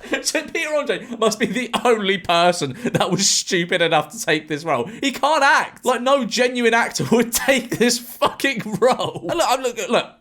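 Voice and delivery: high, incredulous rant broken by laughter; laughing exasperation. Real, not acted. Reviewer mocking [name]'s casting, laughing in disbelief. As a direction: rant through laughter, pitch climbing.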